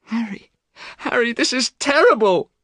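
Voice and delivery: high, loud anguished cry. A narrator's reading. Dorian learning Sybil poisoned herself, loud anguished cry. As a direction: cry out in horror. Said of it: "cried Dorian".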